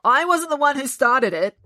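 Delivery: defiant with a stuffed nose; blocked nose voice. Acted. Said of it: "She sounded very nasal".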